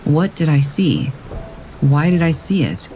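usual speaking voice

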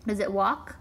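ordinary talk

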